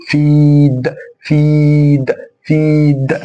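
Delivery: فيد stretched to "feeed"; long vowel. Shown as a demonstration. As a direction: hold a steady long ee in each فيد. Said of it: "أطول أكن الياء ممدود". (فيد بياء ممدودة)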